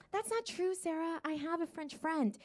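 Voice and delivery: small, high, soft voice; objecting audience member. Acted.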